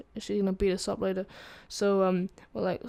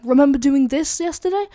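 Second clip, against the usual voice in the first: loud, raised mock accusation; imitated angry principal, louder and higher; accusing principal; 5 semitones higher, 9 dB louder than usual. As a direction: confront them with the evidence. Principal shows the evidence.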